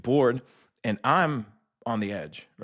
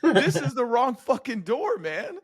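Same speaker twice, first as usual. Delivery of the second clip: laughing panic; 9 semitones higher than usual. Retold or imitated. Nervous jumper protesting to jump master about the door, high laughing panic. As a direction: plead in mock terror, laughing. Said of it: "I'm like".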